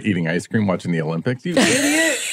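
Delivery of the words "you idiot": high, laughing scornful yell; mocking couch critic. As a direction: jeer at the athletes from the couch. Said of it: "It's like when I'm".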